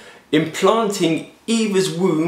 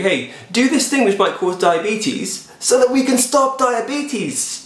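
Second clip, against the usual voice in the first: mocking pitch; 7 semitones higher, slower than usual. Mocks the charity pitch.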